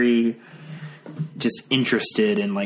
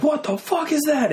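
a much higher, panicked shriek of fright; terrified cry. Retold or imitated. Friend spotting the figure on the stairs, panicked high shriek. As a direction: shriek it like you just saw a ghost. Michael seeing the shadow figure.

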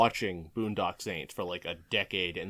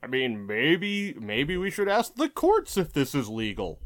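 high, hemming-and-hawing politician voice; mock centrist senators; 8 semitones higher, 3 dB louder than usual. Mimicking timid Democratic senators who defer to courts, mock waffling. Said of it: "Democratic centrist senators being like".

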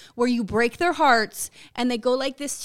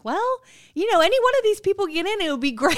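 high gushing voice; 9 semitones higher than usual. Retold or imitated. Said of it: "I was like".

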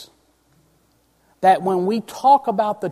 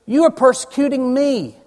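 emphatic quote; 7 semitones higher, 5 dB louder than usual. Acted. Preacher quoting Jesus, emphatic.

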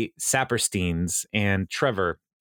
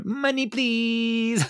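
very high, very slow, drawn-out Mona Lisa whine; high, slow, spoiled whine; whiny Mona Lisa; 13 semitones higher, much slower than usual. Retold or imitated. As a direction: stretch out the demand like a spoiled brat. Mona Lisa asks for money.

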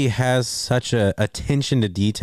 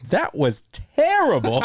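quoting the verdict while cracking up; can't keep a straight face on it; laughing through the quote; 9 semitones higher than usual. Retold or imitated. Retelling his father-in-law's verdict to his cohost, laughing.

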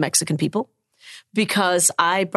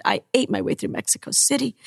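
ordinary delivery

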